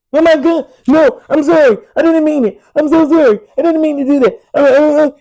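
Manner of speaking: high, loud, whiny fake apology. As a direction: ham up a terrified crybaby begging not to be hit. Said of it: "Then they'll come and say".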